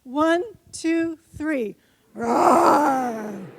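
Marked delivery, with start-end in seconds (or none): 2.1-3.5 tiger roar. Shown as a demonstration. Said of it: "everybody be whatever they are".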